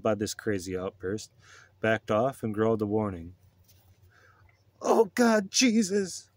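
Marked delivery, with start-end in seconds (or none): 4.8-6.3 high, loud acted scream; frantic, high-pitched yell to heaven; terrified scream. A narrator's reading. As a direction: cry out in panic like a cornered trucker. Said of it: "screamed the trucker".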